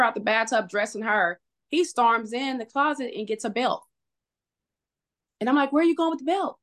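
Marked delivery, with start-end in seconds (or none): 5.7-6.6 retold alarm, pitched high; alarmed questioning. Wife asks husband who grabbed a belt, alarmed questioning. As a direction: imitate a high, worried challenge.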